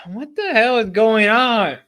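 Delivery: loud, high and slow; exasperated outburst. Real, not acted. Streamer exasperated at the game, loud, high and slow. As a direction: drag it out in exasperation. Frustrated reaction.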